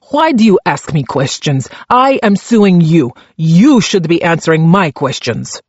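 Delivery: put-on Czech-accented prisoner turning the tables; indignant Czech accent. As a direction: push back hard in a thick Czech accent. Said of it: "his accent so thick".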